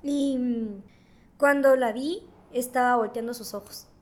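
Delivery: ordinary talk, nothing marked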